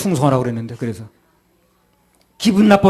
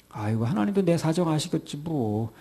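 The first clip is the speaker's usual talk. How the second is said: complacent sigh; 9 dB quieter than usual. Acted. Voicing a complacent believer.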